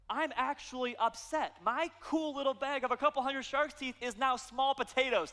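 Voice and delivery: high-pitched, indignant inner rant; mock upset. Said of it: "And I am mad".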